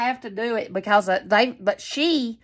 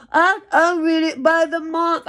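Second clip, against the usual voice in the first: higher, slower mocking imitation; mocking her; 6 semitones higher, 4 dB louder, slower than usual. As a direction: parrot her excuse in a higher put-on voice. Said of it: "she'll be like".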